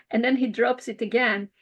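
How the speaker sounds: ordinary delivery, nothing marked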